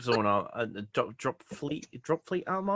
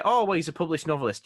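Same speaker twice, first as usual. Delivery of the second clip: fast, mocking tone; mocking quote; 6 semitones higher, 5 dB louder, faster than usual. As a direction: mimic the critics dismissively. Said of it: "to those who say".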